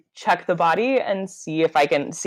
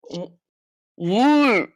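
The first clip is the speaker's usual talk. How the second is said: muffled sounds into the ground; 4 semitones higher than usual. Acted. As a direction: mumble as if face down in mud. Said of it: "it's muffled because I'm talking into the ground".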